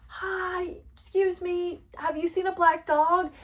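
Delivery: high-pitched, polite call to strangers; calling out, not yelling. Retold or imitated. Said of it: "I'm not yelling, but I'm like".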